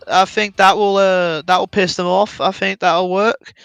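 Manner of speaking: a lightly voiced, friendly Australian rallying his troops; casual Australian commander; nice Australian. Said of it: "General Chungus just like".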